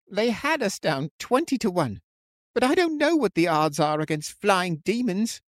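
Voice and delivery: high, fretful voice of despair; distressed. A narrator's reading. Frightened man facing flying demons with his friend, distressed. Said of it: "his voice cracking in distress".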